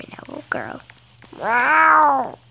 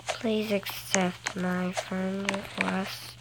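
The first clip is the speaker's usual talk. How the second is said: slow, soft pleading; slow begging request; 6 semitones lower, 9 dB quieter, much slower than usual. Acted. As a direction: drag it out sweetly begging. Begging another player.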